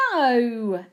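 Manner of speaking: loud, drawn-out no; gleeful denial. A narrator's reading. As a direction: rule it out with relish. Gleeful guessing game, wrong answer.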